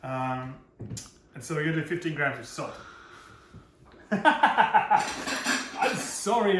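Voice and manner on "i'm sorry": very slow, high, laughing I'm sorry; real laughing apology. Real, not acted. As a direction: giggle through the apology. Laughs at own maths mistake.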